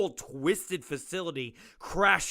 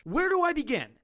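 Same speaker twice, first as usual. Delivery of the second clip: exasperated; 10 semitones higher, faster than usual. Acted. Doctor exasperated.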